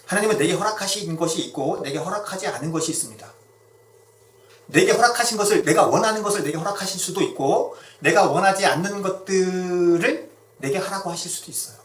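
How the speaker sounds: loud, rising sermon climax; fervent preaching. Acted. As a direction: preach it out with full force. Preaching at full force.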